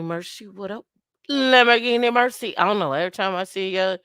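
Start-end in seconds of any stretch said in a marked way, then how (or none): 1.3-2.6 raised, loud Jamaican dancehall call. Acted. Host reacting to a viewer's name, Jamaican dancehall hype. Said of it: "go into a Jamaican dance".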